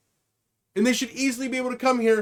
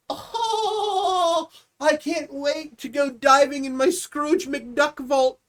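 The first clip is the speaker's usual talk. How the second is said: higher, slow, giggling with greed; gleeful greedy laughing; 5 semitones higher, much slower than usual. Retold or imitated. Executive dreaming of the Scrooge McDuck vault, giggling. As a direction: savor the thought of the money vault, laughing. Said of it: "they're just sitting there like".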